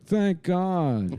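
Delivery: high relieved Ross. Retold or imitated. Ross thanks God, relieved and high. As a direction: pitch it up in relief.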